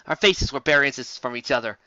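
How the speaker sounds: usual speaking voice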